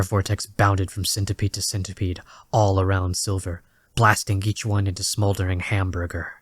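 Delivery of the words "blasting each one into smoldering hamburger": ordinary delivery, nothing marked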